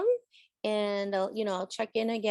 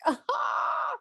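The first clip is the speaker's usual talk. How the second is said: mock yell. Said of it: "be like".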